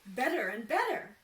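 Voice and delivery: loud gleeful cry; delighted. Acted. Carpenter pleased with each answer, loud glee. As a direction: savour it with glee. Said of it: "said the carpenter".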